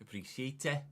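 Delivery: a lightly attempted Welsh accent; a half-hearted try at a Welsh lilt. Thanking a Welsh viewer in a faltering Welsh accent. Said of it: "I can't really do a Welsh accent".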